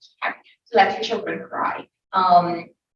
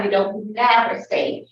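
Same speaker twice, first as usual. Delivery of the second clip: ordinary delivery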